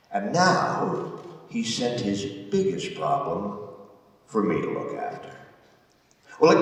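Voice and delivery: dramatic stage delivery, swinging from hushed to emphatic; weighty theatrical telling with long pauses; prison warden's monologue. Acted. Warden recalls his troublesome prisoner, dramatic monologue.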